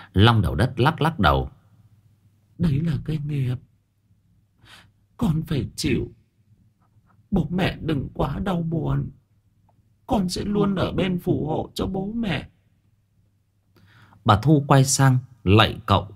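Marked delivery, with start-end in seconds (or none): 2.6-12.5 dead daughter's voice. Acted. Daughter's spirit through Long apologising to her parents, soft ghostly voice. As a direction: speak as the daughter's spirit, regretful.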